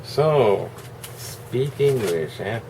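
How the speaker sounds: slow mocking taunt; drawn-out teasing jab. Mock taunt repeated.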